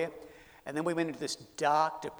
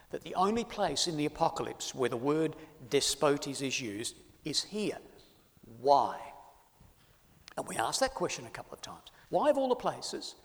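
ordinary delivery

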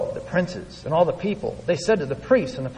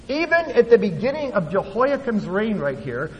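ordinary talk

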